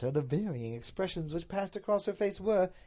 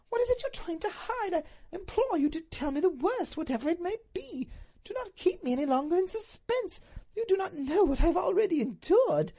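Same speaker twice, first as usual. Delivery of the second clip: very high, imploring lady's voice; frantic Duchess; 4 dB louder than usual. Acted. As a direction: beg desperately for the truth.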